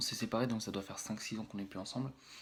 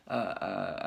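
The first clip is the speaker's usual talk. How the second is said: ordinary delivery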